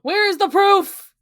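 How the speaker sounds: hammed-up courtroom challenge; mock courtroom demand. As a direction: play the indignant lawyer, loudly. Mock lawyer demanding proof.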